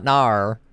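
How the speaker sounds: loud NAR with a hard R ending; mock the Australian NAR, drawn out; Australian-style no. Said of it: "No, it's not nar".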